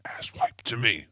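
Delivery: whispered insult; whispered jab at the friend. Acted. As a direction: breathe the insult out under the voice.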